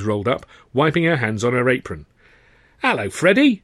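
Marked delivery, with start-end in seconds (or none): 2.8-3.6 bright high cry of hello; cried greeting. A narrator's reading. Landlady greeting Freddy at her door, glad cry. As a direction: call out gladly to a favourite lad. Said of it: "she cried".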